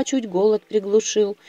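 ordinary delivery, nothing marked

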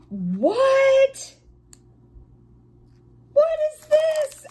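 high, loud amazed What?; shocked by a surprise find; astonished. Real, not acted. Discovers special drill in kit.

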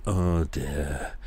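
usual speaking voice

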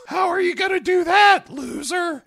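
very high, loud put-on kid jeer; taunting kid. Kid jeers at the pet shop owner, high and loud. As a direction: taunt him in a squeaky child voice. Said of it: "And then the kid asks".

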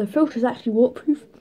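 muffled behind palm; speaking through fingers; hand over mouth. Real, not acted. Seen 0: Boy's hand fully covers his mouth and nose throughout the labelled words.

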